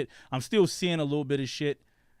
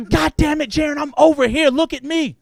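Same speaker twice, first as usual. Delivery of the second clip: high, loud imitation of exasperated mother yelling; screaming mom; 12 semitones higher, 10 dB louder than usual. Mom screaming at son who talks to the TV, high shout. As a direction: yell like a mom demanding attention. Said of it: "my mom is fucking screaming at me".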